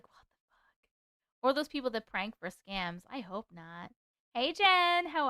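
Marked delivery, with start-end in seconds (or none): 0.0-1.4 hushed, breathy disbelief; stunned under-the-breath swear; shocked whisper. Real, not acted. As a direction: whisper it like you can't believe it. Shocked aside reading chat.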